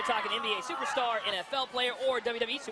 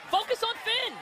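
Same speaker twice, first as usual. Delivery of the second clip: excited; 11 semitones higher than usual. Real, not acted. Coach urging high.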